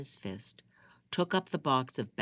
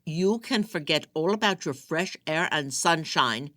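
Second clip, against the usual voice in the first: called through door; 5 semitones higher, 7 dB louder than usual. Acted. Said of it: "he called through the door".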